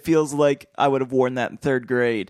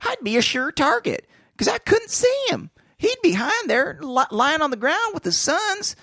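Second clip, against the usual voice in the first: squeaky cowardly henchman; whiny high voice; 13 semitones higher than usual. Acted. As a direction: whine it way up high.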